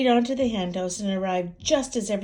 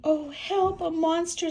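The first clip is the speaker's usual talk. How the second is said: high panicked cry; frightened cry; 9 semitones higher, 5 dB louder than usual. Acted. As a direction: cry out for help. Hen sees monster.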